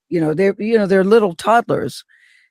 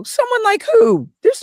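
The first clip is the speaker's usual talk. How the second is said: surprised. Retold or imitated. Incredulous retort to husband.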